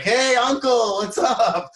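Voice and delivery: high, loud, drawn-out friendly hail; exuberant, high-pitched hello; calling out a greeting. Retold or imitated. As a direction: call out to a buddy across the way. Acting out greeting to neighbor.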